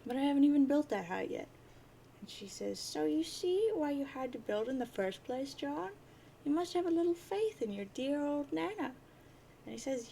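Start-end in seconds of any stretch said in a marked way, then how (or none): 2.8-8.9 high-pitched, indulgent grandmother figure; Nana. Acted. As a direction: chide gently in a high old-lady voice.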